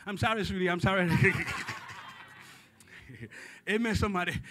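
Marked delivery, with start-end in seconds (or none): 1.2-2.4 breathy laugh. Real, not acted. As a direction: let the laugh slip out. Laughing after apology.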